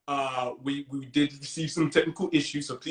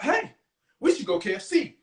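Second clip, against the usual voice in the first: eager suggestion; 8 semitones higher than usual. Acted. Acting classmate's suggestion.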